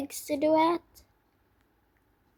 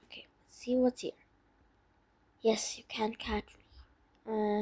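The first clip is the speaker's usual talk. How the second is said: whispering; 3 semitones lower, 8 dB quieter than usual. Pretend. Sneaking through chicken farm.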